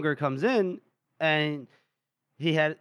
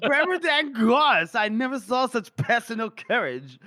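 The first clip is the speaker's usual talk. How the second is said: put-on drunk colonel; 6 semitones higher, 4 dB louder than usual. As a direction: gush tipsily over a hero. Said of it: "and he's, like, drunk".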